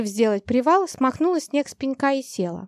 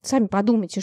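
ordinary delivery, nothing marked

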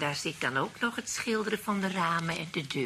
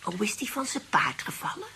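whispered gossip; 5 semitones higher than usual. Acted. Old ladies gossip in private.

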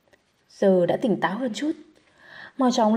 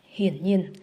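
plain reading voice

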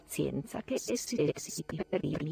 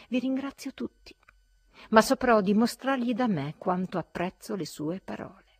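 raised, formal voice; dignified reply; 6 semitones higher, 6 dB louder than usual. A narrator's reading. Zverkov thanking the company, raised and dignified. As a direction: answer the insult with public dignity.

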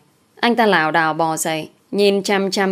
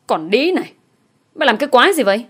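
higher-pitched snarl through gritted teeth; enraged, gritted teeth; 5 semitones higher, 3 dB louder, faster than usual. A narrator's reading. Attacker just kneed by his victim, enraged through gritted teeth. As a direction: spit the insult out in pained fury. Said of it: "nghiến răng nghiến lợi rồi nói".

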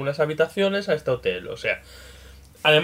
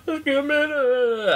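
imitating a guest; 11 semitones higher, much slower than usual. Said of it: "así en inglés".

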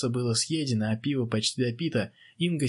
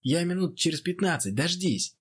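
high raised call over noise; shouting over crowd; 7 semitones higher than usual. Acted. Boxer calling to his friend through the crowd, shouting. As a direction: lift the voice above a cheering crowd. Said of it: "Пытаясь перекричать толпу, закричал он".

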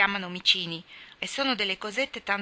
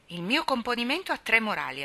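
usual speaking voice

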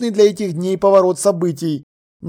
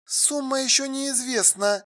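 softer, higher woman's voice cutting in; interrupting; 6 semitones higher, 10 dB quieter than usual. A narrator's reading. Alla breaking into his crash story, interrupting. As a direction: cut in quietly in a higher woman's voice. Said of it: "перебила Алла".

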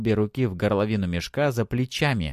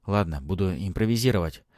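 ordinary delivery, nothing marked

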